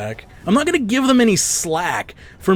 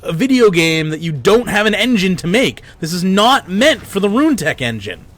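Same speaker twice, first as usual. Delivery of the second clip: angry rant; 5 semitones higher than usual. Real, not acted. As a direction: vent furiously at the developers. Ranting at game developer.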